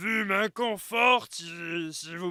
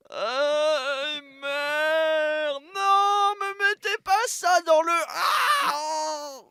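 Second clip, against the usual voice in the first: high panicked shouting; terrified scream; 7 semitones higher, 5 dB louder, much slower than usual. Acted. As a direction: cry out under attack. Being attacked with bucket.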